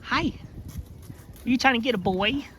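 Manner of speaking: high cooing to an animal; sweet high voice for a pet. Real, not acted. Farmer teasing her goat in high cooing baby talk.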